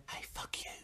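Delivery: soft unvoiced whisper in the ear. Sleeping boyfriend whispering to his partner, a hushed whisper. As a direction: breathe it into her ear, no voice. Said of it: "whispers in my ear".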